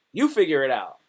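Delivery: ordinary talk, nothing marked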